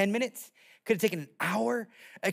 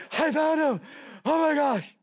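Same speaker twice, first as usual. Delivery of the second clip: louder, high, breathless announcement; out of breath; 6 semitones higher, 3 dB louder than usual. Acted. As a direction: act out a winded shepherd calling his find. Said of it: "he's out of breath going".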